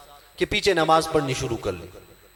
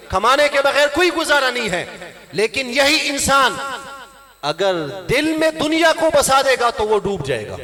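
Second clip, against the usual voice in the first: higher-pitched, emphatic sermon delivery; raised, ringing preacher's voice; impassioned preaching; 6 semitones higher than usual. Real, not acted. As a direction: drive the point home to a congregation. Preaches at full force.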